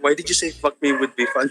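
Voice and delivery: ordinary talk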